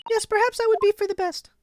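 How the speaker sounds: put-on bright high-pitched young girl voice. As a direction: voice the polite young heroine high up. Heroine voice again.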